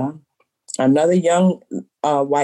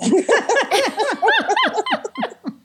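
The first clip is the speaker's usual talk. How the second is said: a long high peal of laughter. Real, not acted. Owner laughing after her comeback to the customer, high laugh. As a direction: let the laugh ring out, high.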